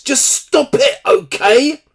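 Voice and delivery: high-pitched, loud, imitated annoyance; very annoyed. Son answering his sister's teasing, very annoyed voice. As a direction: snap it back, voice jumping right up. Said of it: "in a very annoyed voice".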